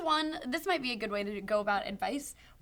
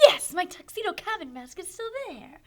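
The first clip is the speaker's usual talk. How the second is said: lightly voiced villain; a hint of a villain gloating; 6 semitones higher, 4 dB quieter than usual. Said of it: "Why do you say it like a, a villain?".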